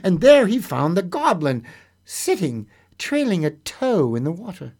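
lively storyteller voice, acting out the tale; story narration. A narrator's reading. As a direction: read it like a bedtime fairy tale. Storyteller reading a fairy tale.

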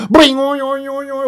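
monster roaring. Acted. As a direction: ring out the clang and then let the monster howl. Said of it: "and the monster roared".

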